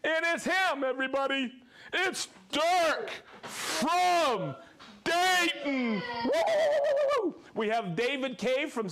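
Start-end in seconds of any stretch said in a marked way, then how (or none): 0.0-7.3 excited. Real, not acted. Hyping a friend's shout-out.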